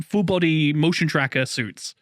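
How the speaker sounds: usual speaking voice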